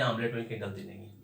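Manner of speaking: ordinary delivery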